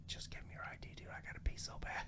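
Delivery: urgent whisper, barely voiced; whispered retold plea. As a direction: imitate a desperate aside to a friend. Travis pleading aside, retold.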